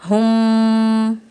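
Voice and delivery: हुम held long through the nose; merged meem with ghunna. Shown as a demonstration. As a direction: stretch हुम slowly with a nasal hum on the मीम. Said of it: "इस मीम को पढ़ रहे हैं हम". (हुम को गुन्ने के साथ लंबा खींचकर)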